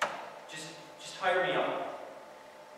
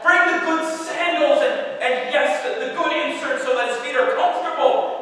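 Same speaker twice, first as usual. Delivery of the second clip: louder and higher, overflowing with glee; joyful calling out; 6 semitones higher, 8 dB louder than usual. Acted. Father calling to the servants over his returned son, jubilant. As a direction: shout orders with joy. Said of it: "he calls out to the servants".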